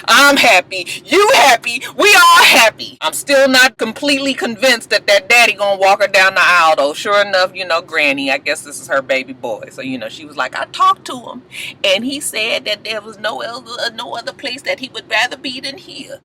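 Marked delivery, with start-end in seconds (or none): 0.1-3.0 building to a high joyful shout; excited. Real, not acted. Joyful build to everyone happy. 10.6-16.2 lightly voiced old grandmother; a hint of a sweet old granny. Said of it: "I had to put my Granny voice on".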